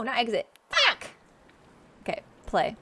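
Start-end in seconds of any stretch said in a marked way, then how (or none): 0.7-1.1 very high, sharp yelp; dismayed curse. Real, not acted. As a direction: blurt out a high-pitched swear. Hit exit by mistake.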